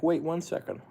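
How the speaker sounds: high, soft little guinea pig voice; voicing a pet. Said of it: "It's like".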